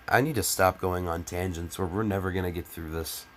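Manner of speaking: normal talking voice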